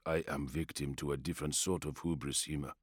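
low, subdued confession; quiet warrior voice. Acted. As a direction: speak it low and rueful. Arai speaks in the story.

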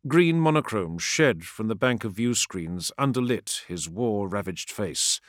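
usual speaking voice

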